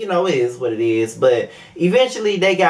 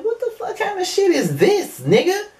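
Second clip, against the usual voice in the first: incredulous reaction. Retold or imitated. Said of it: "I'm like".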